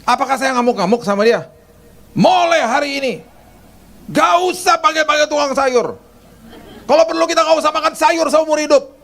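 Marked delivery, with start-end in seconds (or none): 2.2-9.0 much higher, hammed-up tirade; husband pretending to blow up at the maid; mock furious rant. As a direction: rant like an outraged boss, over the top. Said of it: "saya nggak pernah ngomong gitu".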